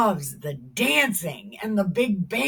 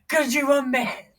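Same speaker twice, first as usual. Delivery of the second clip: normal talking voice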